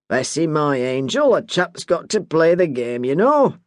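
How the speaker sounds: a hint of a wheedling old man; Bert, easygoing husband. Bert's voice in dialogue.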